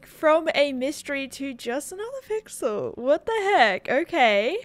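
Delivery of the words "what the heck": ordinary talk, nothing marked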